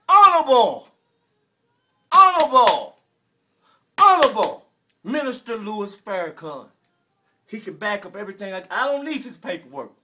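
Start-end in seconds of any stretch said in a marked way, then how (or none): 0.1-6.7 HONORABLE belted louder each time; correcting himself. Real, not acted. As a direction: insist on the title Honorable. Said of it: "I take that back".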